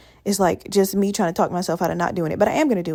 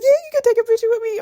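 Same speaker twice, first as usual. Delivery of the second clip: much higher, delighted yes; thrilled yes; 14 semitones higher, 4 dB louder, faster than usual. Retold or imitated. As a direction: agree with barely contained glee. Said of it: "I was like".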